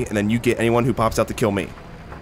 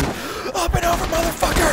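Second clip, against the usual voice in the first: high, slowed, triumphant battle shout; hyped yell. Real, not acted. Gamer charging an enemy over cover, hyped. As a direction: stretch it out like a war cry.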